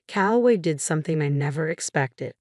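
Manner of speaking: plain reading voice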